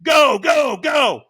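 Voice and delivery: loud, high trainer's yell; screamed urging. Retold or imitated. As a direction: yell them off the start line. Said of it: "screams at me".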